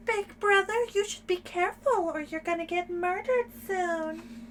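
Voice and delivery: high, put-on character voice; imitated little-sister voice. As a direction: pitch it way up as the character warning her brother. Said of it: "gonna do that in that voice every time".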